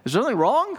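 mock-concerned churchgoers, high pitched; playful worried questioning, pitch climbing; wondering congregation. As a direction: imitate a puzzled congregation asking after their pastor. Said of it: "you're probably wondering".

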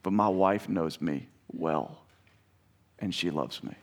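soft, slow, low sermon close; intimate preaching. Acted. Preacher telling the congregation God loves them, softly and tenderly. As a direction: lean in and speak it gently.

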